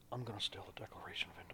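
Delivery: breathy, fast conspiratorial whisper; Nick Cage whisper. Acted. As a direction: whisper it like a movie star with a secret. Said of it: "I've been practicing my Nick Cage".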